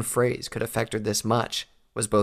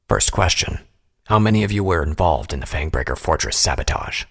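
lower, firm, loud questioning; stern interrogator; 4 semitones lower, 6 dB louder than usual. A narrator's reading. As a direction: question him coldly. Interrogator asks in low voice.

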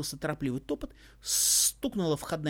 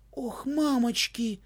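slow, high, drawn out amazement; childlike drawn-out wonder; 8 semitones higher, 4 dB louder, much slower than usual. A narrator's reading. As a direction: stretch it like a child in awe. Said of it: "совсем по-детски протянул изумлённый Егор". (протяжно, по-детски изумлённо)

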